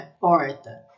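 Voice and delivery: "porta" with a long, pulled retroflex R; strongly drawled R. Shown as a demonstration. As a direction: draw out the R in "porta", up in the mouth. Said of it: "bem puxado".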